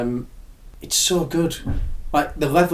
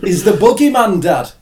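mock northern accent; 7 semitones higher, 9 dB louder than usual. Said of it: "in a northern accent".

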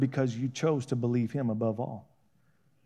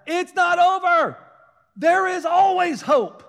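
much higher, louder, slow cry; preaching climax; 8 dB louder, slower than usual. Real, not acted. Preacher promising the congregation hope, crying out. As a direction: cry it out to lift them.

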